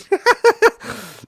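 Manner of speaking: high cackle breaking out; high laugh. Real, not acted. Laughing at own joke.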